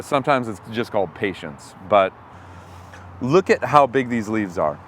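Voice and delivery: usual speaking voice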